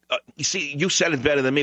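ordinary talk, nothing marked

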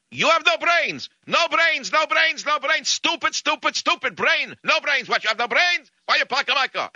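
very high-pitched mock Russian yelling; screaming crazed Russian. Crazed Russian berates the man parked against his bumper, screaming. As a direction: rant in a frantic, high imitation of an angry Russian. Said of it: "screaming and yelling".

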